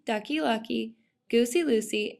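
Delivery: usual speaking voice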